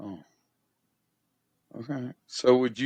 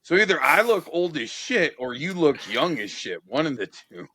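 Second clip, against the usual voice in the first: much higher and louder, stunned disbelief; incredulous outburst; 8 semitones higher, 5 dB louder than usual. Real, not acted. Interviewer learns the guest is forty-seven, incredulous and loud. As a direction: blurt it out, floored by the news. Shocked guest is older than him.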